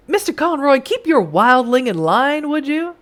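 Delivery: condescending stranger with a hint of Western accent. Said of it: "gave him a Western accent".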